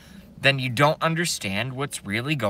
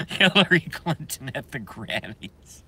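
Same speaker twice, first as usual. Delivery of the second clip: words tumbling out of a laugh; laughing disbelief; 5 semitones higher than usual. Real, not acted. Streamer savouring the Grammys news, laughing in disbelief. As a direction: savour it, still cracking up.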